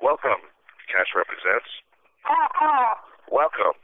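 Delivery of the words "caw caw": very high screeching crow caw; shrill screeching bird woman. Acted.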